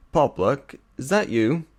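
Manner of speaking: loud call from another room. A narrator's reading. Father calling his son from the kitchen, loud. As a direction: call out to the house. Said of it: "a voice called from the kitchen".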